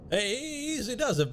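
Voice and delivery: ordinary talk, nothing marked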